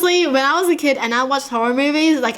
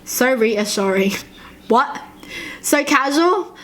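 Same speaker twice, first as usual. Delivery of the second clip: ordinary delivery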